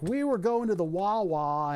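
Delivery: a local Philly guy telling a news reporter; Philly accent. Retold or imitated. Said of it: "Philly accent I've ever heard".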